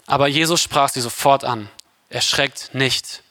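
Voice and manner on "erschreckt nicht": ordinary talk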